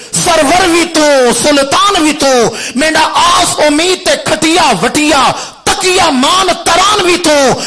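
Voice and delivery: high, loud chant-like recitation, climbing to a shout; impassioned poetry recitation. Acted. Preacher reciting Farid's devotional verse to the crowd, impassioned declamation. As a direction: declaim a devotional poem at full force, drawing out lines.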